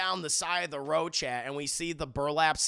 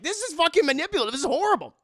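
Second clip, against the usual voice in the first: loud and high, fast, fuming at the screen; real outburst of disgust; outraged rant; 12 semitones higher, 9 dB louder, faster than usual. Real, not acted. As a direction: blow up at how manipulative it is. Streamer outraged at the show.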